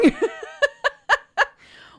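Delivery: high loud burst of laughter; cackling laugh; big laugh. Real, not acted. Laughing at her own outburst.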